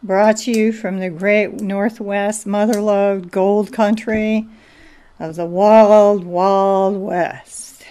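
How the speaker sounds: slow, showman's sign-on; radio promo intro. Acted. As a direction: sell the wild west like an old radio ad.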